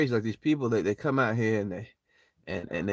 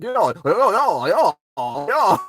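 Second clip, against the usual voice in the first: higher, louder slow drawn-out yos; excited yo calls; 9 semitones higher, 9 dB louder, slower than usual. Retold or imitated. Retelling the club scene, loud awed yo calls. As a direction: call out yo like an awestruck hypeman.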